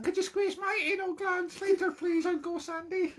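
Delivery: the silly voice that puts Teddy off. Real, not acted. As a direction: squeal at the little doggy pals. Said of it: "this silly voice".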